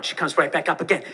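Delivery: usual speaking voice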